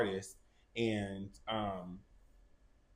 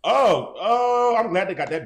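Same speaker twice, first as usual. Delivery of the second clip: much higher and louder, a real cry of glee; excited outburst; 10 semitones higher, 12 dB louder, slower than usual. Real, not acted. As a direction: burst out with real excitement at the news. Shocked reaction to [name] news.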